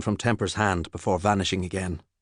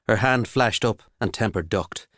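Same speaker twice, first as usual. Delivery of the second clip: usual speaking voice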